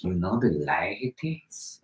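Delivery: low, slow, whispered come-on; hushed mock-seductive murmur; put-on pickup voice. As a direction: breathe it out slowly as a sly question. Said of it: "you use that exact voice and talk".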